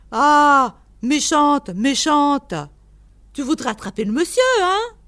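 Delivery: loud, high mock-stern telling-off; scolding a pet. A narrator's reading. Keeper scolds his stoat for going at the visitor, loudly. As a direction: chide a naughty animal, rising on the final "hein". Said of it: "Cette dernière phrase s'adressait à une hermine".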